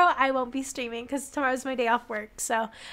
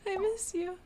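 high, soft little plea; small voice full of longing; quiet pining; 5 semitones higher, 9 dB quieter than usual. Acted. Player answers absent Sunder, small and wistful.

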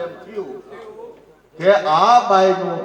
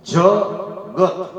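જગત stretched very slowly, every sound held; drawn-out word; much slower than usual. Shown as a demonstration. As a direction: draw જગત out long and slow so each sound is heard. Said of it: "આપણે બોલાય જગત".

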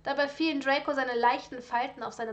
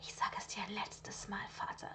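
low, hissed menace through the teeth; hissing warning; 4 semitones lower, 11 dB quieter than usual. Acted. Son hisses a final warning at his father, hissed. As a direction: hiss a last warning at him. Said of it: "doch Draco unterbrach ihn zischend".

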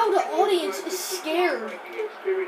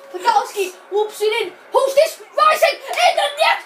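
high, shouted football commentary; excited goal call; 9 semitones higher, 6 dB louder than usual. Real, not acted. Player commentates own goal, thrilled shouting. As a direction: yell the goal in as it happens.

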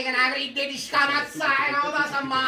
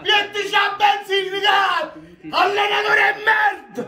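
rallying shout; 6 semitones higher than usual. Real, not acted. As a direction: pump the players up, then curse the coach. Tifoso incita la squadra. (incitamento urlato, acuto)

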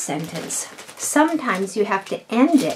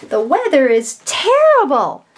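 "terrible" said higher and louder with strong feeling; exclamation; 5 semitones higher, 4 dB louder than usual. Shown as a demonstration. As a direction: declare it with real frustration. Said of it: "that should be an exclamation point".

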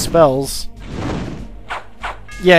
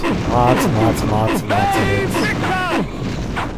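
drawn-out emphasis; much slower than usual. Acted. Stretching out a long fight.

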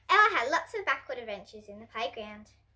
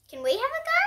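put-on much higher childlike voice; lightly voiced eager twins; 14 semitones higher, 4 dB louder, faster than usual. Twins asking Ella to join, eager and high. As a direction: squeak it like excited little kids.

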